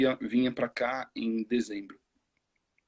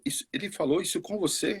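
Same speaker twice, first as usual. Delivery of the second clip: imitating an English accent in Portuguese, much higher; light mock English-accented Portuguese, pitched up; Samoan English accent; 8 semitones higher than usual. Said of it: "com sotaque inglês falando português". (imitação leve de sotaque inglês, bem mais aguda)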